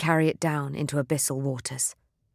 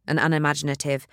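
plain reading voice

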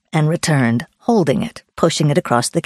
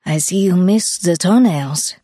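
Swiss-accented wonder, gently voiced; admiring Swiss woman; 2 semitones higher than usual. Swiss woman examining a hiker's feet, admiring, Swiss accent. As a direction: marvel in a light Swiss accent.

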